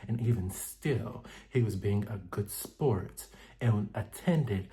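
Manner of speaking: soft, hushed, prim and wounded; Angel voice. Retold or imitated. Recapping the show, doing Angel's soft voice. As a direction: speak quietly like an offended lady. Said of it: "I gotta put on my Angel voice".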